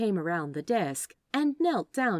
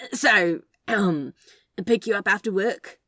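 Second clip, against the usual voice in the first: flustered; 3 semitones higher, 9 dB louder than usual. Acted. Caught flirting, flustered.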